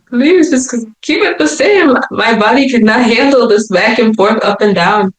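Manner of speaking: high-pitched retold plea; desperate, acted-out appeal; exasperated pleading. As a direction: beg them to stop. Pleads with staff over sugar.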